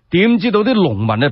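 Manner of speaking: ordinary delivery, nothing marked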